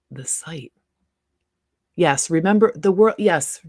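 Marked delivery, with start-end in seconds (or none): 0.1-0.7 soft whispered note to herself; whispered aside. Real, not acted. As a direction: whisper it while searching. Aside while checking.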